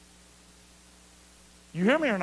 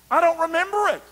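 loud; 10 semitones higher, 5 dB louder, faster than usual. Real, not acted. Preacher's outburst.

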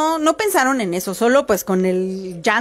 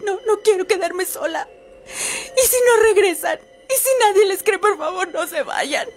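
high, wailing, weepy plea from far off; a buried woman's cry rising from the well; ghostly woman crying for help; 8 semitones higher than usual. Acted. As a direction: wail like a trapped ghost sobbing. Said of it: "la mujer gritó".

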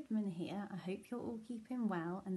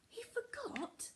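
gasping whisper; 13 semitones higher, 7 dB quieter than usual. Acted. Storyteller gasps in suspense.

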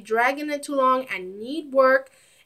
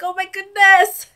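exasperated outcry; 7 semitones higher, 5 dB louder than usual. Retold or imitated. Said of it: "I'm like".

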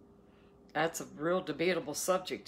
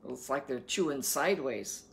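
normal talking voice

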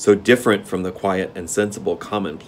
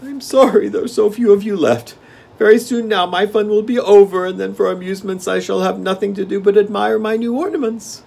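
high-pitched, loud king's voice; gloating Gnome King; 13 semitones higher, 7 dB louder than usual. A narrator's reading. King to his dwindling guests, high and gloating. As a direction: crow in a high royal voice.

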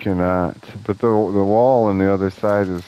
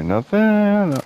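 mock squeak; 12 semitones higher than usual. Empty container letdown.

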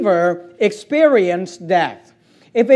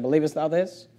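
hushed question; 7 semitones lower, 9 dB quieter than usual. Acted.

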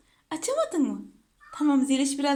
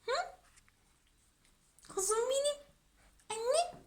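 tender cooing; 10 semitones higher, 6 dB quieter than usual. Real, not acted. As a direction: coo softly to a beloved pet. Cooing endearments to pet. (tiz ve yumuşak, şefkatli)

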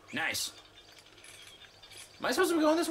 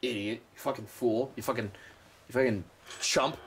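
self-mocking; 7 semitones lower than usual. Real, not acted. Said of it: "I almost said diagram".

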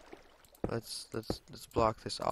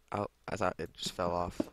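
ordinary talk

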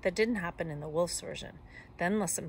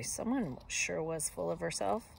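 ordinary delivery, nothing marked

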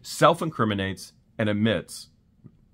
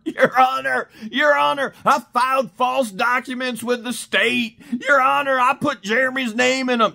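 mock whiny plea; 10 semitones higher than usual. Mocking Crook's pleas.

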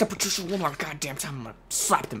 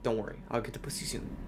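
much softer, lower asides; low muttering; 5 semitones lower, 15 dB quieter than usual. Real, not acted. Player mutters jealously to his horse, soft and low. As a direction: mutter it under your breath.

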